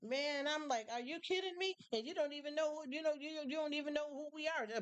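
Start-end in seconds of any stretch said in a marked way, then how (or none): 0.9-1.8 incredulous. Retold or imitated. Her retold reaction, high.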